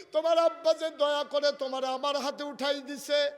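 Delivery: high, full-force lament as Ali; impassioned preaching. Acted. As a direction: cry out the poor husband's confession, loudly. Preacher voices Ali's gratitude. (উচ্চকণ্ঠে আবেগভরা was)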